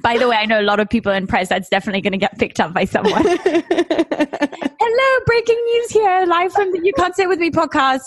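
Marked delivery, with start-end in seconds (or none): 3.2-8.0 high-pitched breaking-news parody; hammed-up reporter voice; mock newsreader. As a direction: announce a silly live bulletin, way up high. Mock live news bulletin.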